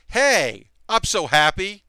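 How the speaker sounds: mock happy voice, pitched slightly up; Sarah Logan. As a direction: lightly imitate a cheery, so-happy wrestler. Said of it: "her voice is just like".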